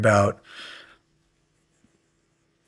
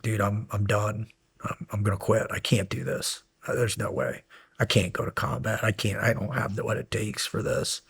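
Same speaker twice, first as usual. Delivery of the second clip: defeated murmur; 9 dB quieter than usual. Retold or imitated. Breaking down, wanting to quit.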